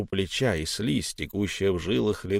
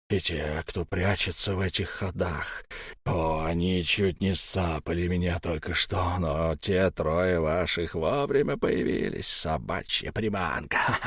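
crazed creature; 4 semitones lower, 4 dB quieter than usual. Acted. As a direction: rave like a feral thing. Elm raves, mad creature.

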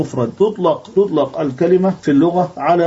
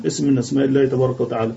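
normal talking voice

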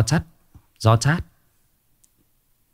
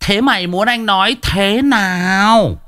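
high, drawn-out wail of exasperation; exasperated outburst; 11 semitones higher, slower than usual. Real, not acted. Streamer answering a viewer who says he's no fun, exasperated. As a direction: stretch the words out, pitched way up.

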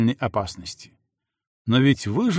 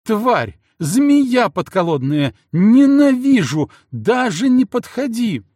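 яростный крик; 10 semitones higher, 7 dB louder than usual. Acted. Жена кричит на мужа.

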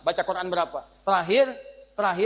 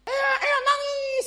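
high wailing newborn cry, hammed up; mock baby cry; much slower than usual. Preacher acting out a newborn's first cry, high mock wail. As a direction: wail like a baby just born.

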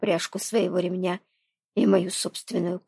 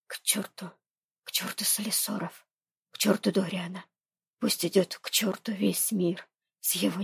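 softer, hushed and jumbled; frantic whisper; 6 dB quieter than usual. Acted. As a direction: whisper it in a desperate rush. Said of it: "шепчу ей сумбурно".

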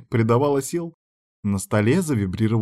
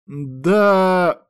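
long, raised hesitant yes; drawn-out; 8 semitones higher, 4 dB louder than usual. A narrator's reading. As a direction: stretch the vowel out high. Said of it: "протянул я".

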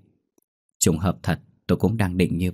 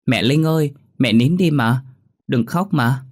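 put-on high, pleading child voice; tearful little girl; 6 semitones higher, 4 dB louder than usual. A narrator's reading. Daughter begs crying mother to stop, tearful pleading. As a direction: beg like a small child upset by mum's tears. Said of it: "Ngọc cũng khóc theo".

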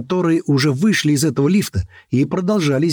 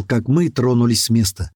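ordinary delivery, nothing marked

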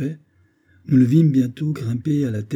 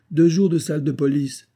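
louder, high-pitched order; stern sentencing; 7 semitones higher, 5 dB louder than usual. Acted. Captain punishes his orderly for the wrong cartridge, stern order.

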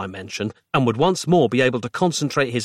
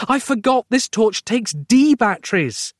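high, frantic-sounding confession; losing control; 11 semitones higher than usual. A narrator's reading. Roz holding the wrong batteries, losing control. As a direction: wail it in mounting panic. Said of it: "starting to lose control of herself".

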